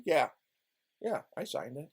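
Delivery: low, soft meek witness reply; a small, meek yes; timid witness. Retold or imitated. Witness timidly admits in court, meek. Witness voiced in his retelling.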